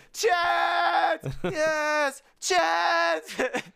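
high, loud holler; high-pitched hammed-up yelling. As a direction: shout at the top of your lungs. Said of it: "yelling together at the top of their lungs".

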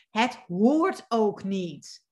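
"Het hoort ook niet" spoken very slowly, each word weighted; slow emphasis. Shown as a demonstration. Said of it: "ik ga het echt nog een keer zeggen". ("Het hoort ook niet" langzaam en met nadruk)

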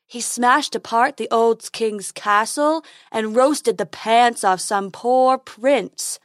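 slow, loud, oafish voice with a thick timbre; dim-witted troll bragging with slow, heavy words; troll Fred. Acted. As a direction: lumber through it like a simple troll.

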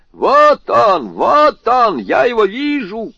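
loud, high shout of discovery. A narrator's reading. Sergeant at the keyhole spotting the thief, loud shout. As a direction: bellow it through the door. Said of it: "крикнул голос за дверью".

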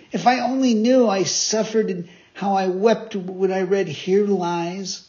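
grieving puppet. Acted. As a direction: relive the sorrow.

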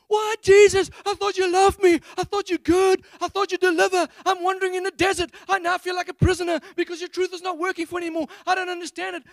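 high, loud whiny wail at God; hammed-up complaining tantrum; mock tantrum. Preacher acting out his tantrum at Jesus, loud high whine. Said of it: "Throw a tantrum".

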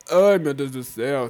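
words pushed out through a burp; talking while burping. Real, not acted. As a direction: speak right through a burp. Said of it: "tô arrotando aqui".

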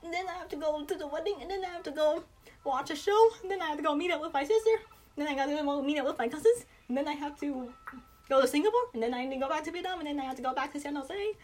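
rattling off plans at top speed; rapid-fire. Shown as a demonstration. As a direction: list it off as fast as possible. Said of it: "or was it way too fast".

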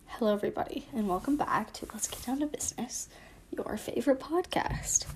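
hushed whisper of the show intro; whispered podcast intro. Acted. Host whispering the opening of her podcast. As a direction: breathe the welcome out without voice.

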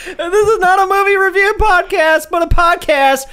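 high, upbeat show intro; podcast host intro. Acted. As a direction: announce it like a host. Redoes podcast intro with energy.

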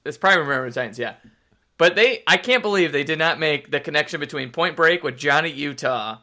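usual speaking voice

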